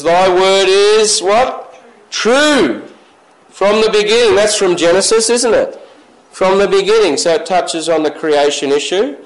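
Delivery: fervent pulpit reading; preaching. Acted. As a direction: pull the congregation into the verse. Preaching scripture with questions.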